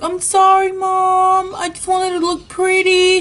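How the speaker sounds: put-on high, pleading child's voice; girl apologizing to mom; apologetic little girl. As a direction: beg mom to forgive you. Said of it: "I was like".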